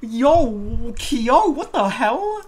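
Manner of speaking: high, loud shocked outburst. Real, not acted. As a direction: yell in disbelief. Shocked reaction to joke.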